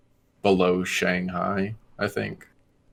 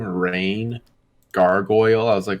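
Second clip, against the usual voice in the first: ordinary delivery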